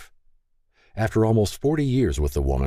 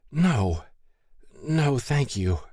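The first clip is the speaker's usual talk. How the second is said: higher, halting, understated shiver; mumbled through chattering teeth; 8 semitones higher, much slower than usual. A narrator's reading. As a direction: refuse weakly, pitched up, as if teeth chatter. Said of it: "she managed to mumble through chattering teeth".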